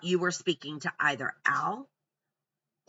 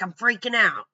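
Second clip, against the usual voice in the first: mock panic; 4 semitones higher, 6 dB louder than usual. Letitia quoted panicking.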